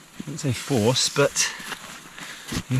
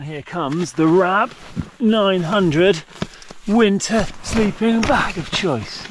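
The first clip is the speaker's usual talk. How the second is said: higher, louder, slow showman reveal; grand announcement; 8 semitones higher, 4 dB louder, slower than usual. Real, not acted. Vlogger unveils his sleeping bag, excited showman voice. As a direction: present it like a game show prize.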